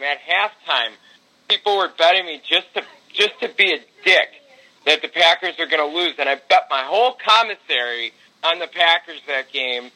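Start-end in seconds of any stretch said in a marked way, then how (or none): none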